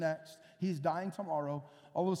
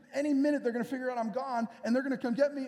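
nervous rush; 4 semitones higher, faster than usual. Retold or imitated. Peter nervous outside, fugitive.

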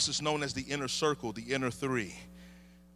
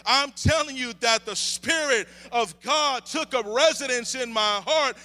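high, loud sermon delivery; impassioned preaching; 8 semitones higher, 7 dB louder than usual. Real, not acted. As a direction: testify at full force, stretching key words. Preaches at full force.

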